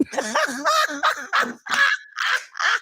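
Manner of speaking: long, high cackling laugh; real laughter. Real, not acted. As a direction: burst out laughing at the meme. Cracking up at the meme.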